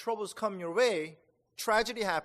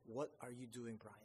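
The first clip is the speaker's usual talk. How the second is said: self-rebuke; 5 semitones lower, 14 dB quieter than usual. Retold or imitated. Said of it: "and just go".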